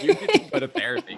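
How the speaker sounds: very high, loud laughing imitation; mimicking teacher. Student mimicking her acting teacher's blunt advice, laughing. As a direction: squeal the teacher's order through laughter. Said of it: "He's like".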